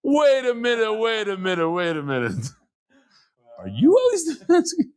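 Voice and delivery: a mock-astonished skier, starting high and fading, then a laughing high shout; hammed-up realisation; sudden recognition. The skier on the lift recognizing the rabbi, in mock astonishment. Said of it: "he looks at me and goes".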